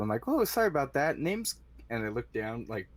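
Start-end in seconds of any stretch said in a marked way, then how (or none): 0.3-1.6 high-pitched, flustered apology; startled old man. Acted. Old mopper to the guard who surprised him, startled. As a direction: act caught off guard, pitched up. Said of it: "I'm startled".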